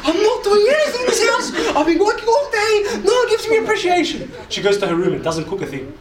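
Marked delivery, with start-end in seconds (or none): 0.0-4.3 very high, loud mock-mum rant; hammed-up shrieky tirade; fed-up mum. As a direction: play an exasperated mother yelling back. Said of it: "Mum gets asabi because I'm asabi".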